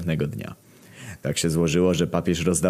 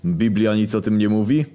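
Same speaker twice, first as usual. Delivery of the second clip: ordinary delivery, nothing marked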